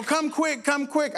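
put-on high, pleading kid voices; urgent kids. Kids hurrying the pastor to the injured boy, high urgent pleading. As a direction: beg him to hurry like a child.

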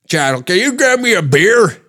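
high holler for service; yelling degenerate uncle. Acted. Uncle calling for a beer, mock holler. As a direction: bellow a request like an uncle. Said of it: "degenerate uncle that's in the corner that's yelling".

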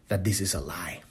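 barely audible; hushed reveal. Acted. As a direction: drop almost to a whisper. Reveals the lie, drops voice.

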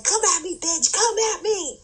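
squeaky mock fighter, playing it silly; cartoon boxer taunt. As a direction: dare her like a 1940s cartoon dog putting up its dukes. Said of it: "like, dog in a boxing ring cartoon".

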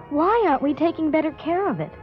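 ordinary talk, nothing marked

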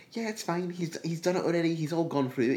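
high-pitched mock reassurance; imitated breezy mum. Mum on the phone about surgery, imitated breezy reassurance.

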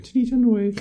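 "Tinny tannoy" in a very high put-on voice; silly squeaky echo. Podcaster relishes odd phrase, silly squeaky voice.

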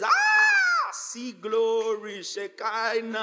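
drawn-out, slow gasp of wonder; awestruck Peter. Acted. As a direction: act out being overcome by glory. Acts out Peter awestruck at seeing glory.

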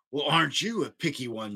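a touch of haughty disdain; lightly voiced huffy monster; sniffy. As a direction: sniff it off as an offended girl monster. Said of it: "she sniffed".

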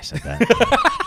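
high, loud, laughing admission; breaking into laughter; cracking up. Real, not acted. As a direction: laugh helplessly at your own slip. Cracks up at own wording.

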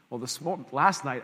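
ordinary talk, nothing marked

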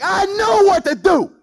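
high, loud scoff with a laugh; mock Naaman's cocky know-it-all; laughing, high-pitched prideful brag; smug proud boast. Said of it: "he's like".

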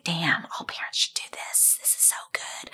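hushed secret whisper. Retold or imitated. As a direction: breathe it like a secret. Whispered inner thought.

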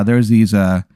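normal talking voice

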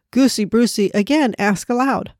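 ordinary delivery, nothing marked

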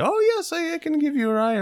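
very high falsetto, badly faked; Salma Hayek. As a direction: attempt a woman's voice way up high. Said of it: "I can't do it".

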